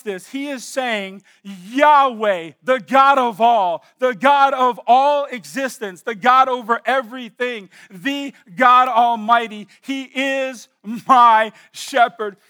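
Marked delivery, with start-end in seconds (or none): 1.4-12.4 rising, louder proclamation, slow and weighty; preaching at full force. Acted. Preacher to congregation, declaring God's names in loud proclamation. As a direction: call out each title of God louder.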